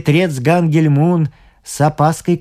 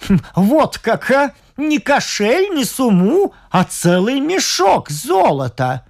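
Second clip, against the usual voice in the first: sniggering judge; 7 semitones higher than usual. Acted. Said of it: "хихикнул судья Гангель Мун и в притворном испуге".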